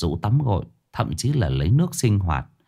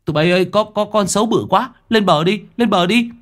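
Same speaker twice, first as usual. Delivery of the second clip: terrified scream; 9 semitones higher than usual. A narrator's reading. Boy in a tree warning friends of the monster, screaming. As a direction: yell a warning from the treetop, rising in panic. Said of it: "tôi rú lên".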